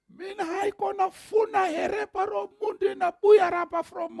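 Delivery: high, fast shouted refusal; angry farmer shouting. Retold or imitated. Farmer angrily refusing to sell the pastor poles, shouting. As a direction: rattle it off like a furious farmer.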